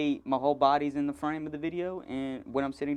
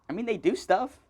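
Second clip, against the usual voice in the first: ordinary delivery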